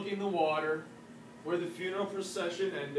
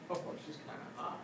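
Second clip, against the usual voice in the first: hushed, lower, sudden admiration; smitten aside; 4 semitones lower, 12 dB quieter, faster than usual. Acted. Emperor seeing the crying woman up close, hushed and smitten. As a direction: drop to an almost whispered aside.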